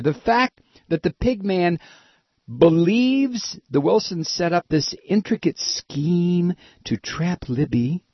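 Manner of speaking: normal talking voice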